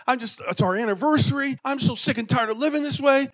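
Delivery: high, loud complaining rant; mock griping. Husband pacing the bedroom, griping to God in a high whine. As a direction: rant like a hammed-up whiny husband. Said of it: "I'm like".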